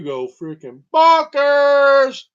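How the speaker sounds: loud, slow, drawn-out exclamation; exaggerated shout. Real, not acted. Telling listeners about prion disease, slow exaggerated shout. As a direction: blurt it out big for comic effect.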